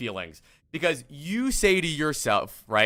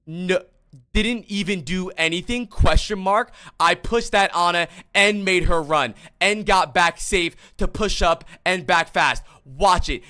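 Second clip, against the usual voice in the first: louder, hammed-up retelling of James arguing back; mock heated rebuttal; 4 semitones higher, 8 dB louder than usual. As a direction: throw his defensive reply back at him, a bit louder.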